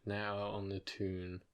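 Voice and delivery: low, quiet, slow copy of Geordie; tentative Geordie imitation; Geordie echo. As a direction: mull over the Geordie words softly. Guesser echoes the Geordie line.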